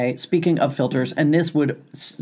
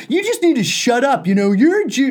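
hostile man; 8 semitones higher, 4 dB louder than usual. Retold or imitated. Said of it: "And he goes".

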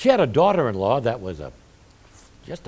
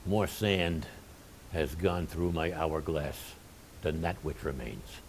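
put-on slow, low, hushed elderly preacher; Haddon Robinson; 6 semitones lower, 13 dB quieter than usual. Elderly professor reflecting at a seminar, slow hushed imitation. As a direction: quote him softly and low, with weighty pauses. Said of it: "I wish I could talk like him".

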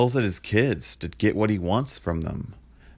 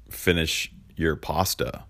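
ordinary talk, nothing marked

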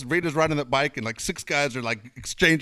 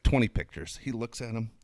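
usual speaking voice

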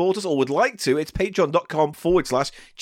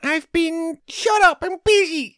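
much higher, strange cat voice; talking cat; 9 semitones higher, slower than usual. Acted. The cat Biscuits tells the boss to shut up, high cat voice. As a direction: play a cheeky cat snapping back.

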